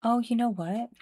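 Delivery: normal talking voice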